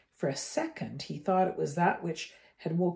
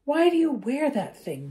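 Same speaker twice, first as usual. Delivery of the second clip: curious; 9 semitones higher, 7 dB louder than usual. Acted. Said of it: "he asked curiously".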